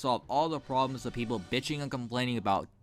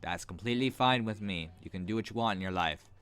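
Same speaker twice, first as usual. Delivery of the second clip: usual speaking voice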